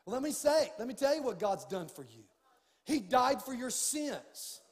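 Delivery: loud, slow, rising preacher's cry; preaching at full force. Acted. Pastor urging his church to go all in, loud preaching. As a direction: build it to a shouted climax. Building sermon climax.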